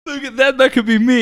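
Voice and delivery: high, loud wistful sigh of a fan; dreamy fan voice. Retold or imitated. Friend joins in, mock dreaming of being the player, high gushing voice. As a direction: gush like a fan seeing himself on the pitch. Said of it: "and be like".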